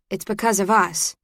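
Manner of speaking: ordinary delivery, nothing marked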